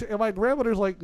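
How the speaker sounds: ordinary delivery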